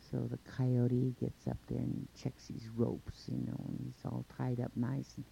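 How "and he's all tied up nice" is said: plain reading voice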